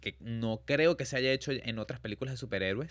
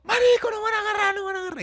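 very high, loud panicked yell; panicked shout; 9 dB louder, faster than usual. Retold or imitated. Protesters fleeing the Guardia Nacional, yelling in panic. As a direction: shriek like the cops are coming. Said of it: "¿qué dice todo el mundo?".

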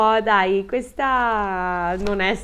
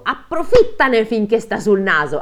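urgent command; 4 semitones higher, 7 dB louder than usual. Acted. Husband urging wife to strike.

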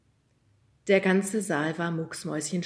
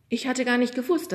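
plain reading voice

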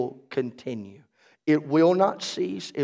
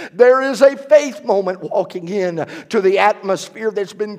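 rousing prayer, louder and rising; roaring rallying speech; 4 semitones higher, 4 dB louder than usual. Acted. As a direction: pray it like a battle cry.

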